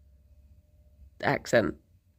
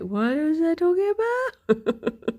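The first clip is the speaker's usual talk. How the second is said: high, drawn-out puzzled question; mock bafflement; 9 semitones higher, slower than usual. Streamer imitating someone petting empty-headed Jerry, mock bafflement. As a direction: climb higher and slower in playful disbelief.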